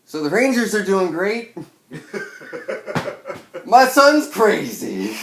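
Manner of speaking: high, loud, hammed-up chatty mom; mock mom small talk. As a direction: play the clueless cheerful parent. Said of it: "What the fuck do you say?".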